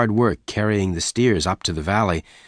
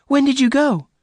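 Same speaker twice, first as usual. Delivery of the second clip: much higher, urgent question; alarmed; 5 dB louder than usual. Acted. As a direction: Bess presses him, frightened. Bess still alarmed.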